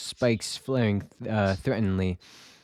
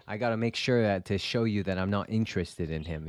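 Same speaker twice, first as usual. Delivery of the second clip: ordinary delivery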